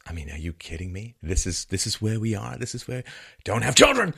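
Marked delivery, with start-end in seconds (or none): 3.4-4.1 higher-pitched mock slogan, punched out; mock rallying cry; mock propaganda. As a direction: parody a propagandist pushing a message.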